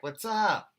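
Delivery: loud, high acted-out holler; calling out a greeting. Host retelling how he greeted a reporter, high loud call.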